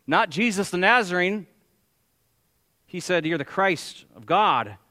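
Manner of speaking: forceful preaching. Acted. Preacher to congregation on why blood must be shed, forceful preaching.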